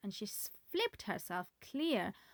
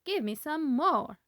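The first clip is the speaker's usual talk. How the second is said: demanding; 6 semitones higher, 8 dB louder than usual. A narrator's reading. Grandma demanding more.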